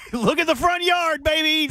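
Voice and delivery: high, drawn-out gleeful proclamation; hammed-up homeowner showing off; mock brazen boast. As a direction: crow it proudly, stretching the words. Mock announcing a rock garden.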